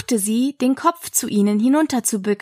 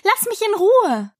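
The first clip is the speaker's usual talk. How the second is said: high, affronted retort; indignant; 10 semitones higher than usual. A narrator's reading. Alice fends off the pigeon, indignant. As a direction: push the attacker away indignantly. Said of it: "sagte Alice mit Entrüstung".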